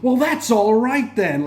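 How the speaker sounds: a smug villain toying with the Doctor, slow and gloating; smug Toymaker. Retold or imitated. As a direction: savour each word like a gleeful trickster. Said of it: "the Toymaker went".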